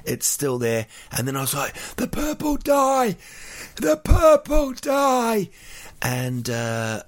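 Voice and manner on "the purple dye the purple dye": high, loud, slow shrieking alarm; panicked scream. Retold or imitated. Shouting to everyone in the hot pool, panicked high scream. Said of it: "I screamed that out twice".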